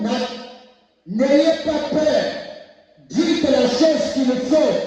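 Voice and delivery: exhorting the congregation; fervent preaching. Real, not acted. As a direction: press the crowd to speak out.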